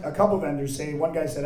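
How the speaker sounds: normal talking voice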